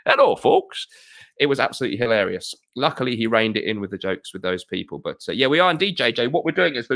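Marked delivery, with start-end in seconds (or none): 0.0-0.9 much higher, louder cheery host greeting; a game show host beaming hello to the folks; Jim Bowen. Retold or imitated. Host imitating Jim Bowen's greeting to viewers, high and loud. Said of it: "hosted by politically incorrect comic Jim Bowen".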